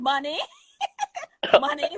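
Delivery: said through a laugh; giggling answer. Real, not acted. Joking answer amid shared laughter.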